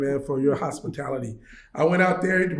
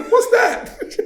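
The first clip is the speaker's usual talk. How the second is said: high-pitched, laughing startled cry; mock startled, much higher and louder; startled question; 6 dB louder than usual. Hearing a noise from the taxi roof, startled question.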